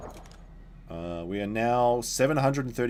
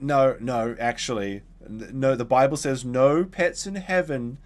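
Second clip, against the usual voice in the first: slower, louder, firm mock pronouncement; strict religious mum; 4 semitones higher, 5 dB louder, slower than usual. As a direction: imitate a stern mother laying down the rule.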